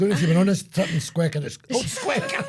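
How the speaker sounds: cracking up into a high shriek; laughing. Real, not acted. Man laughing at mispronouncing the town name, shrieking. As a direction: laugh harder at his own slip.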